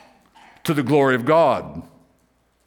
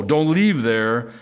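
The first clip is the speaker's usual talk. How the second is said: ordinary talk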